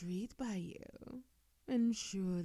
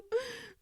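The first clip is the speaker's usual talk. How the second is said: a quick lofty chuckle; short high laugh. Acted. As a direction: laugh lightly, amused and superior. Haughty vampire amused.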